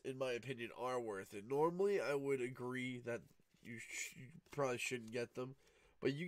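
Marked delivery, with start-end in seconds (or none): none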